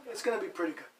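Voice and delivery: normal talking voice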